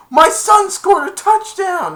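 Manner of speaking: very high, loud, slow cheering yell; proud excited shout. Retold or imitated. Ted's dad in the stands, very high proud yell.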